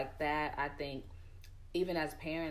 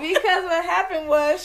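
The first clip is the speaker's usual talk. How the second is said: loud, high laughing start; can't hold the laughter in; 12 semitones higher, 15 dB louder than usual. Real, not acted. Laughing while starting the story.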